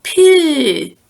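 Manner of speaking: loud, high yell of disgust; loud mock yell. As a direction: yell it out at the stink.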